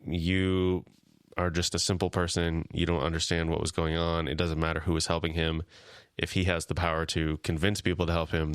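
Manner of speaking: normal talking voice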